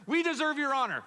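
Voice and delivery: high, loud mother claiming her due honor; Mary rebuking Jesus for the search, high and loud; offended parent. Acted. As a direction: scold like a wounded mother. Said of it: "In our culture".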